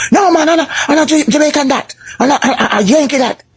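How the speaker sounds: high, scornful patois denial; mock Jamaican denial. A Jamaican disowning [name], high and scornful.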